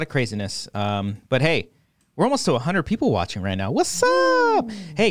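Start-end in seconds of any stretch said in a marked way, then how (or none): 3.7-5.1 much higher, hyped stream shout-out; squealed high hello; excited greeting. Acted. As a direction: greet the chat like a hype man.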